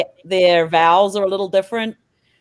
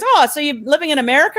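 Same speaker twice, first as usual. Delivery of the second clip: higher, quick imitated bank clerk; bank lady; 6 semitones higher, faster than usual. Bank lady quizzing her about America, imitated higher voice. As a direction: imitate a curious New Zealand bank lady. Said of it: "the lady at the bank goes".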